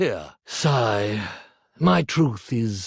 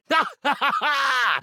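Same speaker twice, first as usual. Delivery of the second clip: theatrical laugh; 6 semitones higher than usual. Acted. Laughing at a joke.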